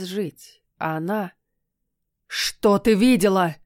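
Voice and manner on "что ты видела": sudden loud slow roar. Acted. Cat suddenly roaring at the heroine, demanding answers. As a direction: bellow the question at her. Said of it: "неожиданно взревел Люцитор".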